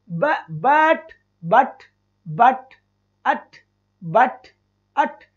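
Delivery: बट split into ब and ट with a short अ between; sounding out but. Shown as a demonstration. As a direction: slowly stretch बट, then isolate अट. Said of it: "ब और ट के बीच में एक ए की sound".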